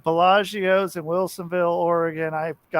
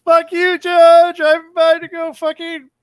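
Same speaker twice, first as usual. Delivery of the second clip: loud, high mock yell at Joe; 9 semitones higher, 7 dB louder than usual. Friend mock-yells at the boss from a car, loud and high. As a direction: yell it at him from a passing car, hammed up. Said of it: "mock yelling at Joe".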